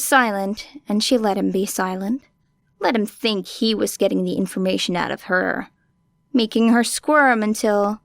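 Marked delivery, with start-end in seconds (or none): none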